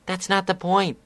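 a touch of a furious filly yelling; angry shout. Acted. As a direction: snap it out at him. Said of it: "Scootaloo shouted angrily".

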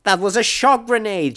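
high-pitched female character yelling; exasperated yell. A narrator's reading. Companion yelling over the wrong grenade, exasperated yell. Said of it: "she yelled".

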